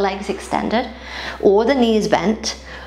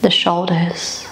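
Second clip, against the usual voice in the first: calm, even meditation voice with long pauses; guided body scan; 4 dB quieter than usual. Acted. Yoga teacher guiding listeners through a body scan, calm meditative voice. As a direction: guide them gently through the body, leaving space between phrases.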